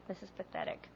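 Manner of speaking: very soft, near-whispered grumble; acted disgusted aside. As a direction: grumble it privately, almost without voice. Retells her disgusted inner remark.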